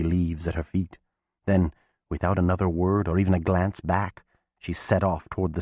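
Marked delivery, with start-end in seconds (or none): none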